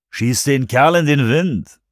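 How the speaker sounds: drawn-out, smooth-talking man; purring come-on. A narrator's reading. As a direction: purr it like a cocky pick-up. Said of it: "schnurrte der Mann auf dem Beifahrersitz".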